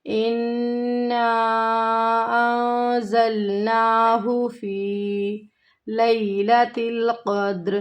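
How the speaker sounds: slow, drawn-out tajweed chanting; Quran recitation. Shown as a demonstration. Teacher chanting the verse slowly for her students. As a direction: recite each word long and measured.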